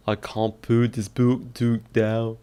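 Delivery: halting attempt at a Scottish accent; Scottish vowel practice through a whole sentence; practising Scottish accent. Shown as a demonstration. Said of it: "How to do a Scottish accent".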